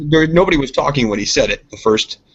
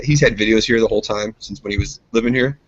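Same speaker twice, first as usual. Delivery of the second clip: ordinary talk, nothing marked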